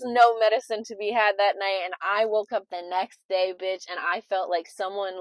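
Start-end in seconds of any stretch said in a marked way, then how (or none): none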